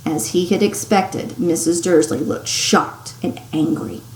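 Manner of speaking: plain reading voice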